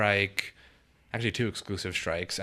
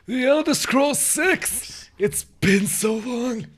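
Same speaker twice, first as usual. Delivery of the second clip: put-on high, slow old New York man voice; old New York guy; 6 dB louder, much slower than usual. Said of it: "new variation in your old man voice".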